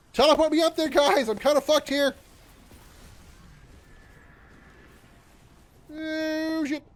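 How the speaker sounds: high, loud panicked yelps; real alarm at being swarmed; gaming panic. Real, not acted. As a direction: freak out as they close in. Pleading while trapped in game.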